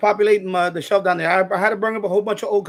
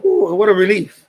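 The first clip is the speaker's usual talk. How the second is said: relieved; 2 semitones higher, 8 dB louder than usual. Real, not acted. Cold towel after heavy lifting.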